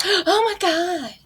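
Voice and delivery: high sarcastic gasp; mock gasp. Mock gasp at baby purchase.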